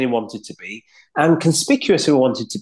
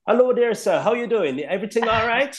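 put-on higher, lilting Jamaican-accented greeting; 8 semitones higher than usual. Code switching to greet a Jamaican neighbour, lilting Jamaican accent. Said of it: "one of my Jamaican neighbours".

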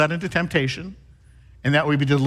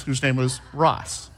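usual speaking voice

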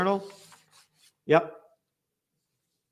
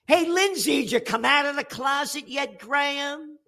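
high taunting jeer; mocking taunt; 9 semitones higher than usual. Real, not acted. Host taunts Lindsey Graham, high mocking jeer. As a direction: needle him like a schoolyard bully.

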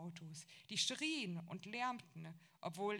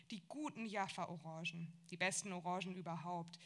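plain reading voice

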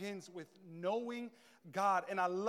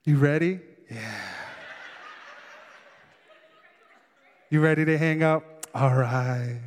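giggly late night call; 10 semitones lower, 11 dB louder than usual. Retold or imitated. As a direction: tease back and forth, low and giggly. Lovers won't hang up.

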